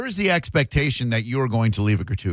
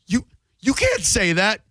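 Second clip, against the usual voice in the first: high, put-on indignant protest; offended customer; 7 semitones higher than usual. Customer objecting to the server's thanks, acted offence. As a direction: imitate an affronted customer, pitched up.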